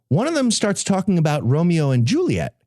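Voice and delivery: normal talking voice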